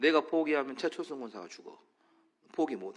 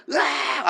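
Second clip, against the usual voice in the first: high, loud mock cry of pain; 10 semitones higher, 6 dB louder, much slower than usual. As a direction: cry out as if struck. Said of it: "막 소리 지르면". (아파서 지르는 비명)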